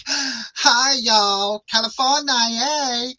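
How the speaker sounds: drawn-out, put-on Californian doll accent; mock Californian accent. Host imitating how Bratz dolls talked, mock Californian accent. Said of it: "Brats were like".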